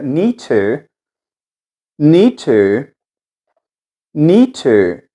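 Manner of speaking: need to with the D sound dropped, run together as nit e; linked need to. Shown as a demonstration. Teacher drilling the linked need to for students, run together. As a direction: join need to smoothly, no D, said like nit e. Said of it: "Eu tiro o som do D e conecto".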